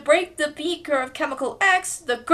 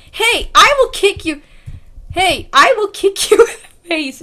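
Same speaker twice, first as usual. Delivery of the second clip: loud, higher mock threat; Beast Boy threat; 6 semitones higher, 9 dB louder than usual. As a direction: bark it as a tough Beast Boy. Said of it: "Should be Beast Boy".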